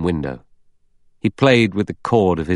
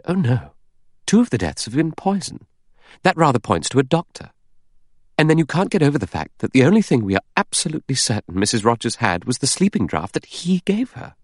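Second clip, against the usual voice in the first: insistent, higher-pitched woman character; Vera; 8 semitones higher than usual. Acted. Vera arguing that the doctor is guilty, insistent. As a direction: argue the case in a raised, lively pitch.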